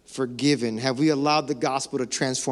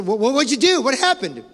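exasperated questioning; 8 semitones higher, 6 dB louder than usual. Retold or imitated. Pressing a vague apologizer.

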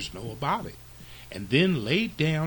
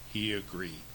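ordinary delivery, nothing marked

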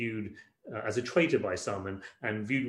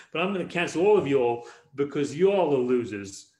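put-on Texas accent; 6 dB louder than usual. Jesus cancels all his bickering followers, loud Texas accent. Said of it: "doing it in a Texas accent".

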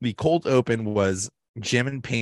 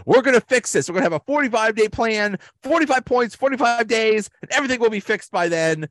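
hammed-up Michael speech; 12 semitones higher, faster than usual. Michael promising shareholders a forty-five day plan, high and hammed-up. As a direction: play the boss hyping up a hostile crowd, sky-high. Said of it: "Michael takes the mic and says".

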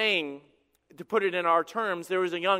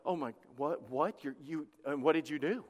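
soft, low, halting disbelief; hushed shock; 6 semitones lower, 9 dB quieter than usual. Acted. People reacting to the preacher's story about his kids, hushed shock. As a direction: stammer quietly, rising into a stunned question.

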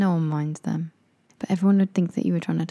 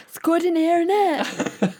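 shouting over music; 12 semitones higher, slower than usual. Pretend. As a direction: yell as if music drowns you. Party small talk shout.

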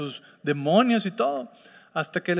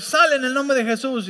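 commanding rebuke; 8 semitones higher, 6 dB louder than usual. Retold or imitated. Retelling a failed deliverance command.

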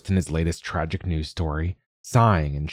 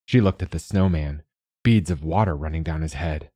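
ordinary delivery, nothing marked

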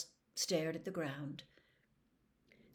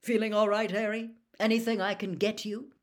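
fatherly; 3 semitones higher, 7 dB louder than usual. A narrator's reading. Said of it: "with a fatherly expression on his face".

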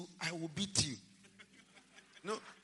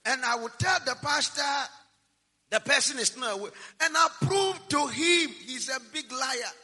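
loud defiance; 3 semitones higher, 6 dB louder than usual. Acted. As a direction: bellow it as a challenge. Preacher shouting his defiance.

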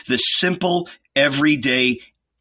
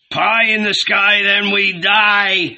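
high loud heckling shout; 9 semitones higher, 5 dB louder, slower than usual. A narrator's reading. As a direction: heckle from the crowd. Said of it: "a voice shouted".

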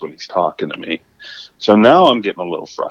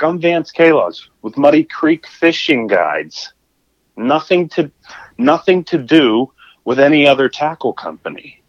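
firm indignant intro; 6 semitones higher than usual. Retold or imitated. Introducing himself to rude man.

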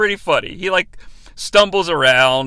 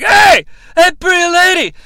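mock calling out; 8 semitones higher, 7 dB louder than usual. Alex hollering to pretty lady during arrest, mock shouting. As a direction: imitate a drunk yelling across the street.

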